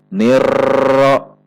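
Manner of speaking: "nirro" with a loud, long, even rolled r; constant-pressure trill. Shown as a demonstration. Example of wrong steady pressure, long trill. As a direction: hold the r of "nirro" at one pressure. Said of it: "dibaca dengan tekanan yang tetap".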